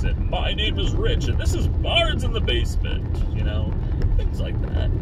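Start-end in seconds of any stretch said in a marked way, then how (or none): 0.0-3.2 high-pitched put-on announcer voice; radio announcer. Shown as a demonstration. Host demonstrating his show intro, radio announcer voice. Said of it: "working on your radio announcer voice".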